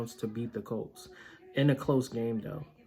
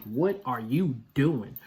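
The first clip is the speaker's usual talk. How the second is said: usual speaking voice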